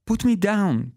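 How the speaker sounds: put-on high child's shout; a small boy echoing the demand. A narrator's reading. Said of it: "Petey shouts too".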